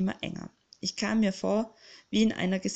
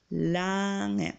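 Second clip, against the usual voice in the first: drawn out. Acted. Arka mockingly echoing the word, drawn out. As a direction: stretch the word out long. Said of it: "wiederholte Arka gedehnt".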